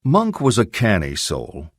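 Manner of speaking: ordinary delivery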